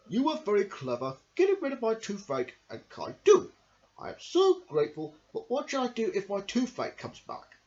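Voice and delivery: grateful crocodile. Acted. Crocodile thanking the clever mouse, grateful. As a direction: thank the mouse, then worry. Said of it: "he said to him".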